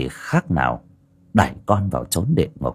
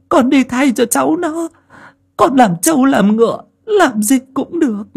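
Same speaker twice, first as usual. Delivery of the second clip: put-on high, pleading old woman's voice, much higher; begging old woman; 3 dB louder than usual. A narrator's reading. Old mother begging the landlord to take her instead, pleading. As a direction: beg desperately, voice pitched way up. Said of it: "lạy lục van xin".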